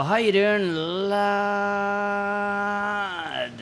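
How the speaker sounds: pause, then hold "lad" high and steady for seconds; cool, hard greeting with an endlessly stretched "lad". Shown as a demonstration. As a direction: draw "lad" out into one very long held vowel. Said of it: "longer you stretch out the word".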